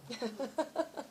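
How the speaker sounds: short, soft laugh; a little laugh to round off the answer; quiet real chuckle; amused laugh. Real, not acted. Laughs after her answer.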